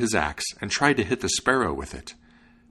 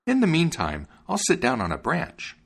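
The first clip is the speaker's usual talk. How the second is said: high-pitched little bird character; high, indulgent bird; obliging sparrow; 8 semitones higher than usual. A narrator's reading. Sparrow talking to the dog.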